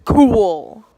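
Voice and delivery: loud, drawn-out exclamation; mock enthusiastic. Patient hearing she is at seven centimeters, mock enthusiastic. Acted reply to dilation news.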